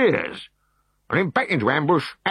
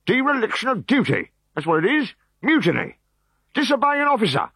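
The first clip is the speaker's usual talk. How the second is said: high, loud angry tirade; furious officer; 4 dB louder than usual. Acted. Decurion berating his legionaries, high and furious. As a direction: tear into the cowards.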